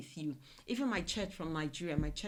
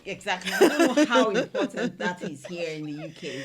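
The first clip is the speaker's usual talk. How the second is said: much louder, laughing through the words; laughing agreement; 2 semitones higher, 12 dB louder than usual. Real, not acted. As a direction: burst out laughing as you agree. Laughing agreement about home food.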